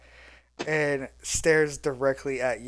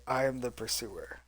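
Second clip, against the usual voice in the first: heavy metallic robot voice, quieter; 4 semitones lower, 11 dB quieter than usual. Acted. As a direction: speak like a giant metal machine. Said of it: "you hear the heavy metallic voice".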